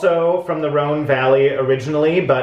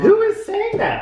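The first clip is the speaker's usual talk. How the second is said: incredulous. Real, not acted. Incredulous reaction to bored drinker.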